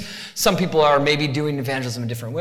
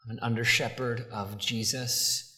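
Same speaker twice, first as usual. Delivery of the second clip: normal talking voice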